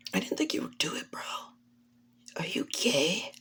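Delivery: hushed, bashful whisper; soft acted embarrassed murmur; shy whisper. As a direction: whisper it as if flustered and blushing. Blushing character's shy reaction.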